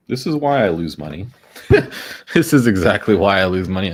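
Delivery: loud laughing self-mockery. Real, not acted. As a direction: laugh through the words. Laughing at own habit.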